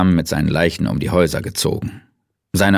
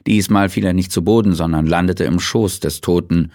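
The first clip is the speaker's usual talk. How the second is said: ordinary delivery, nothing marked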